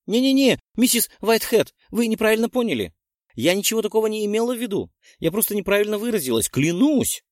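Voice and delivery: put-on girl hastily justifying herself to the witch; flustered excuse. A narrator's reading. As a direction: gabble out the excuse. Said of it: "Попыталась она оправдаться".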